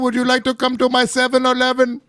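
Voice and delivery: high-pitched hammed-up Indian accent; exaggerated Indian-accent impression; mock Indian accent. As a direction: put on a caricatured Indian accent, pitched well up. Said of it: "but now it's Indian".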